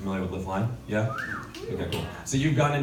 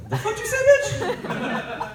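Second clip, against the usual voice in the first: high shrieked outrage, much higher; outraged shriek; 6 dB louder, much slower than usual. Retold or imitated. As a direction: shriek it in mock disbelief. Comedian's furious outburst at mom.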